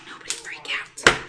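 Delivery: hushed, trying to keep calm; a breathless whisper begging nobody to panic; whispered plea. Real, not acted. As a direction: whisper it, no voice at all. Self-soothing mid-baking mishap.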